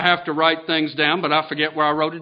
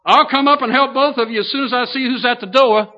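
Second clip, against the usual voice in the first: higher, fast, lightly hammed-up yell of an old man; mock old man yell; 7 semitones higher, faster than usual. Youngest brother at the kitchen table calling up, a higher, fast mock yell. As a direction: rattle it off, sure he's the sharp one. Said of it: "Then he yelled".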